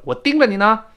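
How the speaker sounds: 盯 said high and prominent; 盯着你呢 with stress on 盯. Shown as a demonstration. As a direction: lean on 盯. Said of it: "跟中文一样".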